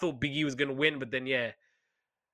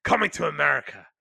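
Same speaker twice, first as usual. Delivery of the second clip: loud, quick boss pitching an idea; lightly voiced excited executive; Vince McMahon; 3 semitones higher, 4 dB louder than usual. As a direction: burst out with a bright idea, then announce it. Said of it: "I do think Vince McMahon, I'm sure he's like".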